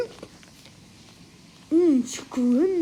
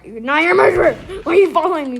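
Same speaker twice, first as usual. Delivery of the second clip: indignant shout; loud yell; 3 semitones higher, 9 dB louder, faster than usual. Real, not acted. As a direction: shout accusingly at a follower. Yelling at player following.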